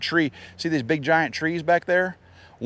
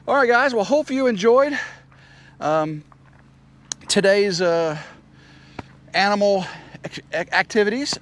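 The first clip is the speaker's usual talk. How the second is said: upbeat outro; 7 semitones higher, 3 dB louder than usual. Acted. Outro to viewers.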